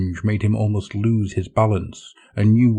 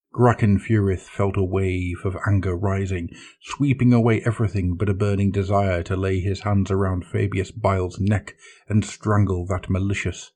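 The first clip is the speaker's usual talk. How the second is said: ordinary delivery, nothing marked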